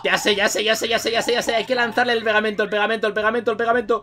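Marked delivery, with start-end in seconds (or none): none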